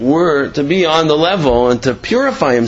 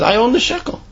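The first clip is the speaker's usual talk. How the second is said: higher, matter-of-fact boast; proud claim; 9 semitones higher than usual. Retold or imitated. The other man tells the doctor, proudly. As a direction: stake your claim to the coin.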